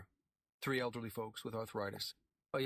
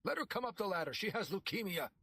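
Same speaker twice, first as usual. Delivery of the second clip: higher urgent plea; pleading; 9 semitones higher than usual. A narrator's reading. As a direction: plead for a sick woman. Pete begging.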